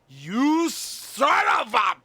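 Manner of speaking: high, slow, drawn-out yell; hammed-up shout of fake outrage; loud stretched-out insult; mock outraged yell. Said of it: "although I really wasn't that".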